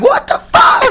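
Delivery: high, laughing confused cry; baffled Jesus. Retold or imitated. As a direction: squeal in disbelief. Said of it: "Jesus standing there like".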